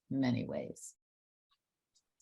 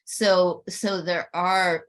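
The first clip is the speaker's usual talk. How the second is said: normal talking voice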